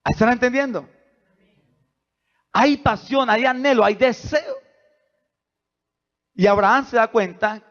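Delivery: high, loud, fervent sermon delivery; preaching at full force. Acted. Pastor telling Abraham's story to the congregation, preaching at full force. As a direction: drive each line home to the church.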